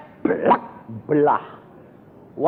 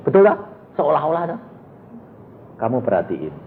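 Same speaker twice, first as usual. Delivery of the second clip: usual speaking voice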